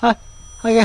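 ordinary talk, nothing marked

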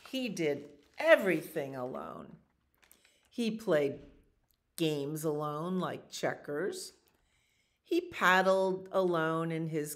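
animated picture-book narration; reading to children. A narrator's reading. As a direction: tell the story to little listeners. Reads picture book aloud.

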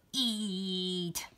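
low, soft monster voice; creepy volcano voice. Acted. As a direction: murmur it like a lurking monster. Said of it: "好可怕".

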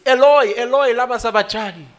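high, loud anguished cry to God. Acted. Preacher voicing Jesus crying out to God in anguish.

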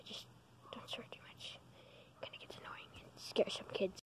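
breathy whisper, barely there. Real, not acted. Kid whispering advice about swearing to viewers, unvoiced whisper. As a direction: hush it to the camera. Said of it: "Dude, can you stop whispering?".

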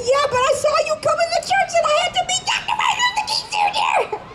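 put-on high, fast, laughing girl's gush; girl explains to Dr King, giddy and fast; excited child. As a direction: tumble it all out breathlessly with glee.

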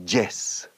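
"yes" said as "jess"; hard j at the start of "yes", a Spanish learner's error; mispronounced Y. Shown as a demonstration. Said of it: "Porque la gente dice yes".

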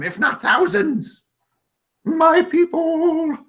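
high, urgent little goblin voice, slowing at the end; a small creature pleading for his people; goblin Vus. Acted.

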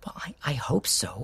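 soft whispered reply, half amused. Acted. Husband to wife in bed at night, whispered. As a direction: hush it so only she hears.

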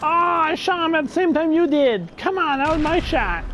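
raging gamer. Real, not acted. Gamer disputing a shared kill.

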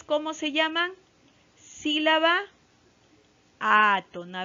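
normal talking voice

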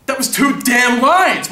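slow, emphatic outburst; indignant actor blowing up; outraged. Acted. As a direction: protest it loudly, word by word. Actor outraged at tiny part.

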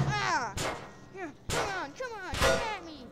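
high, loud goading calls; taunting challenge. Acted. Fighter daring his opponent to attack, taunting.

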